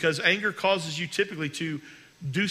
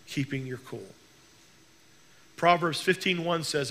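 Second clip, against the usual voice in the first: ordinary talk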